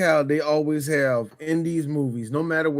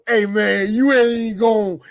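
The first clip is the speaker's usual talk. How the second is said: drawn-out, raised-pitch storyteller's hook; 4 semitones higher, 4 dB louder, slower than usual. Acted. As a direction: stretch it out, louder and slower.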